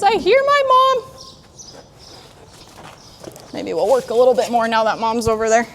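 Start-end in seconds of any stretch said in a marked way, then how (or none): 0.0-1.0 much higher, slow whiny foal voice; voicing the horse. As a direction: play the foal calling for mom. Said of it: "He says".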